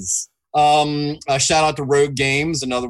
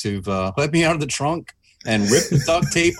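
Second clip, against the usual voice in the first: normal talking voice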